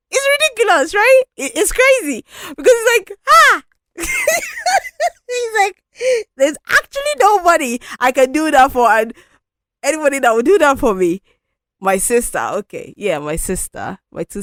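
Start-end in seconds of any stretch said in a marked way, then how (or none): 0.1-11.2 high, loud laughing through tears; real emotion cracking into a pained laugh; laughing cry. Real, not acted. Vlogger laughing and near tears about having no bridesmaids. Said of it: "making me feel emotional now".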